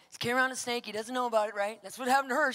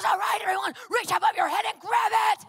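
high, loud rallying order; shouted command; 8 semitones higher, 8 dB louder than usual. Retold or imitated. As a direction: command the whole room at full voice. Woman commanding crowd, retold.

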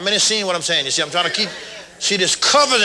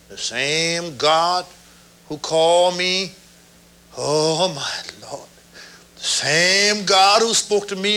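reverent slow preaching; 2 semitones higher, slower than usual. Real, not acted. Reverent slowed preaching build.

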